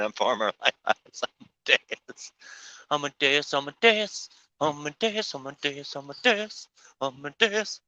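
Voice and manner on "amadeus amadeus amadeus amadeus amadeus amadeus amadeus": sing-song. Pretend. Playfully repeats a name.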